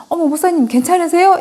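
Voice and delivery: high-pitched, suddenly solicitous exclamation; retold startled concern. Sister retold greeting her pastor after the crash, startled and solicitous.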